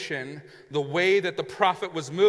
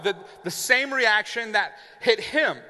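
usual speaking voice

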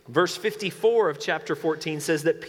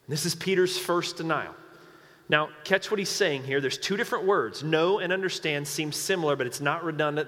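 ordinary delivery

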